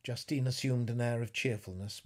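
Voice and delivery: ordinary delivery, nothing marked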